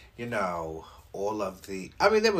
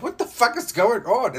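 retold high-pitched alarm; 13 semitones higher, 8 dB louder than usual. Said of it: "I'm like".

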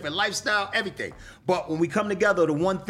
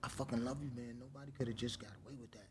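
much softer, fast, intimate warning; hushed retold warning; 5 semitones lower, 19 dB quieter, faster than usual. Telling loved ones they got away with it, much softer and fast. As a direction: drop the voice low to a loved one, then rush the words. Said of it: "I'd be like".

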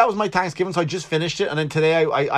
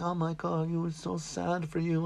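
a soft, higher imitation of pitying friends; mock pity; 4 semitones higher, 11 dB quieter than usual. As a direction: imitate friends fussing over him, softer. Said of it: "everyone's like".

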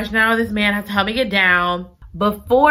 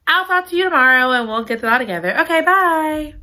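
high, rapid mock farewell; hurried goodbye; 7 semitones higher, faster than usual. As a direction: rush them out, bye lifted high. Voices Chloe's hurried goodbye.

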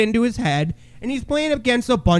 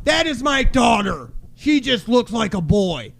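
mock indignant dad, pitched higher; acting out an affronted parent; imagined offended father; 4 semitones higher than usual. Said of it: "and he'll be like".

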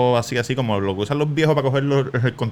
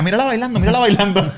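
gloating nurse; 7 semitones higher, 4 dB louder than usual. Retold or imitated.

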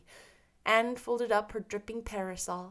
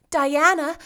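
raised, loud call of a name; Anne calling out; 6 semitones higher, 11 dB louder than usual. A narrator's reading. Anne alarmed, calling out to Diana. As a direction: call Diana urgently, in character. Anne calls her friend.

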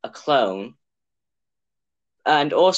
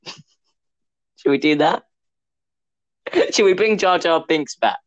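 excited planning; 3 semitones higher, 4 dB louder, slower than usual. Real, not acted. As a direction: giggly pitch of a silly idea. Joking plot ideas, laughing.

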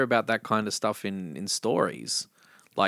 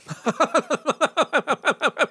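high-pitched manic giggle; creepy high cackle. Real, not acted. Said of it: "I wish everyone could see that laugh".

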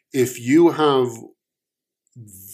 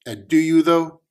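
doubtful challenge; 7 semitones higher, 6 dB louder than usual. Acted. Omen doubting.

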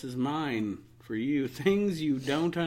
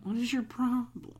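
mock exasperation; 8 semitones higher, much slower than usual. Ranting at slow drivers.

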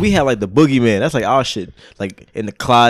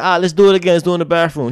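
eager dare; 5 semitones higher, 5 dB louder, faster than usual. Retold or imitated. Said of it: "I said".

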